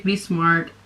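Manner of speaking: usual speaking voice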